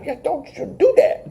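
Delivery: high, soft mock pleading; squeaky little hushed telling-off; scolding his child. Wrestler talking to his child, high soft mock scolding. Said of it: "he's talking to his child like".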